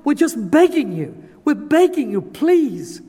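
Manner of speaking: high, loud, begging cry to God; earnest pleading prayer. Shown as a demonstration. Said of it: "earnest crying out to God and saying".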